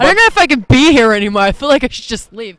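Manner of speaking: loud, hammed-up huff; mock offended. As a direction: play it wounded and loud. Mock offended threat to leave.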